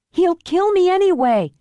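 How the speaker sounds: high-pitched, desperate cry; desperate daughter. Acted. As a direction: cry out as a frightened hostage. Terrified hostage cries out to rescuer.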